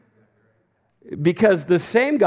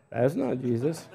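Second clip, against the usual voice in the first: quiet disapproving verdict; 7 dB quieter than usual. Retold or imitated. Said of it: "it's always better when you hear".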